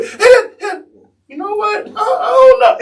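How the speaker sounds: high, loud, half-laughing frantic yell; hangry outburst. Retold or imitated. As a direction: blurt it out dazed and starving. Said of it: "It was a Snickers commercial".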